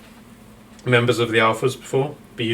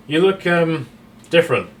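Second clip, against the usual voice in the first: Scott's voice; 6 semitones higher, 4 dB louder than usual. Acted. Scott eyes Kashan.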